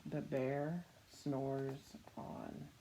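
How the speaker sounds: very slow, soft, drawn-out refrain. A narrator's reading. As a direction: let the line fall asleep. Refrain of the picture book.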